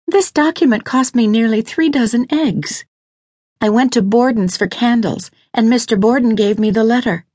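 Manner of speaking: high-pitched woman's character voice, urgent; earnest, worried wife. Acted. Lydia argues that Mouse has been waiting, urgent.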